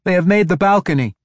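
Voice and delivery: high, loud alarmed report; frantic young messenger's cry; urgent cry. A narrator's reading. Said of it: "the younger woman cried".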